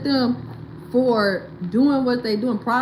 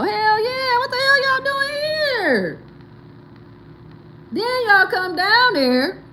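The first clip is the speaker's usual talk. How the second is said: higher, slow, mock-outraged local; 10 semitones higher, slower than usual. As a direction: drawl it out like an annoyed local asking why they came. Mocking Mexicans' reaction.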